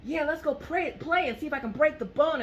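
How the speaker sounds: put-on very high, eager little girl; neighbour's little girl about breaking bones, high child voice. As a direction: play the gory kid, bright and keen.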